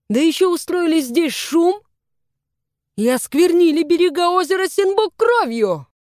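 indignant scolding climbing in pitch; mounting anger, listing their offences; angry reprimand. A narrator's reading. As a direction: build from stern rebuke to high outrage. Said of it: "продолжил вконец рассердившийся молодой господин Ли".

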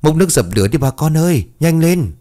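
high, hurried cry for help; urgent shouted call. A narrator's reading. Villager calling neighbours to fight a fire, urgent shout. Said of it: "một người bấy giờ liền hét lên".

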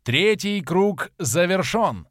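slow, loud, ringing announcement; herald's proclamation. A narrator's reading. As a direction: proclaim it slowly to the crowd. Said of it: "провозгласил глашатай". (торжественное провозглашение глашатая)

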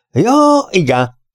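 high, drawn "ja" dropping down; old man. A narrator's reading. Old man finally understands. (magas öreges hangon)

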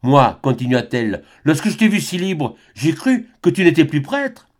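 high-voiced young woman begging; a lover's despairing plea; Albine, pleading. A narrator's reading.

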